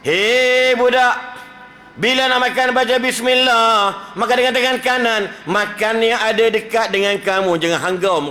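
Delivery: high, loud, slow calling voice; Prophet advising boy. Acted. Preacher voicing the Prophet teaching a boy table manners. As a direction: call out to the boy gently but firmly. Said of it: "kata empat".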